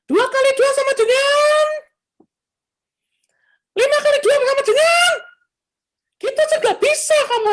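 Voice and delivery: put-on very high, loud yell drilling a child; mother yelling times tables. Neighbour's mother yelling math drills at her son, high and loud. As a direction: scream the sums at the kid. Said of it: "pagi-pagi buta pasti kedengaran suaranya".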